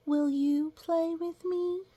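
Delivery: high, slow, sweet asking; childlike asking. A narrator's reading. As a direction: ask like a small hopeful child. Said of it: "I said".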